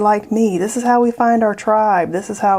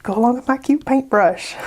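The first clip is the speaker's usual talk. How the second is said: higher, sing-song cute bragging on the first line; mock cute show-off; 5 semitones higher than usual. To her viewers, showing off outfit and pencil: playful high cute bragging. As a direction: show off the outfit like a proud little girl. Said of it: "As I was saying".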